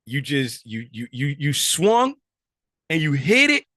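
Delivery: rising excitement, building through you you you to you hit it; excited praise. Real, not acted. Teacher praising a student's near-right answer.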